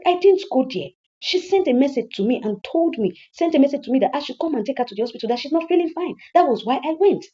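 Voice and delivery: high-pitched, urgent explaining; indignant self-defence; defensive protest. Acted. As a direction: plead your case to your mother. Son defends himself to mother.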